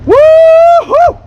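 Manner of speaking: high, loud rising whoop; loud woo-hoo. Real, not acted. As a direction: cheer like a cowboy. Cheer before fishing.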